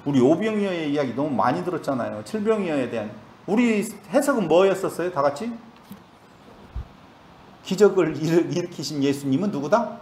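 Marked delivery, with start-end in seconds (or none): none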